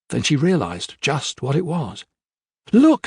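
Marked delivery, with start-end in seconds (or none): 2.7-3.1 much higher, louder alarmed call; girl spotting something in the sea; crying out. A narrator's reading. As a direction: shout to the crew in alarm. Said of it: "she cried".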